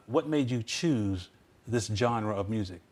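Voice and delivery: ordinary talk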